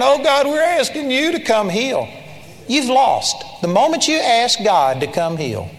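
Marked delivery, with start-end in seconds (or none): 0.0-2.1 higher-pitched mock prayer; lightly acted, raised-pitch plea; imitated pleading prayer. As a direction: mimic people begging God to act. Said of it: "this is how people are praying".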